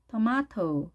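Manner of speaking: British tomato, tuh-MAH-toh; British style. Shown as a demonstration. Teacher contrasting two options, British tomato as tuh-MAH-toh. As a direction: keep the T in tomato, British long AH. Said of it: "영국식을 할 때는 T 발음을".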